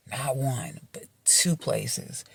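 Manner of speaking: soft, slow, hushed stress on "two places"; stressed whisper. Real, not acted. Telling viewers about her friend's leg, hushed and emphatic. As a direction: drop to a breathy hush and lean on "two". Stressing how bad the injury is.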